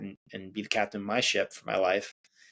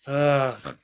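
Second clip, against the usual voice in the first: sigh; 4 semitones higher, 3 dB louder than usual. Shown as a demonstration. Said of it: "can even let out a sigh".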